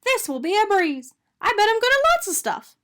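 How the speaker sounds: high, loud confident donut; excited donut voice. A narrator's reading. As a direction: brag it eagerly. Arnie boasting.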